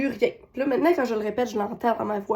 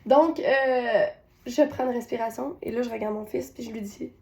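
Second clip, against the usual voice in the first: ordinary talk, nothing marked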